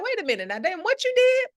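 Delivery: high, loud disbelief; hammed-up amazement at elders' secrets; mock astonishment. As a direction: jump up in pitch, amazed. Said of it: "I'd be like".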